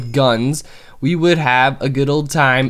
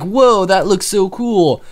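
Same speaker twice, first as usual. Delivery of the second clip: slow, higher mock wonder; retold amazement; 6 semitones higher, slower than usual. Gamer retelling first seeing the panda, mock awe. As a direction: replay your old awe, lightly. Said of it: "and I was like".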